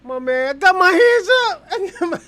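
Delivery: very high mock plea breaking into laughter; mock pleading. Host joking he's got his hands up, laughing mock plea. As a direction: squeal it in fake panic, then crack up. Joking reaction to pepper spraying.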